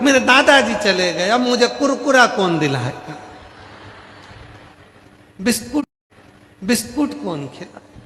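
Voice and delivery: high, mock tearful child voice; crying little grandson. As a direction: whine like a weeping little boy. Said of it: "छोटा सा पोता रो रहा था". (रोते बच्चे की नकल)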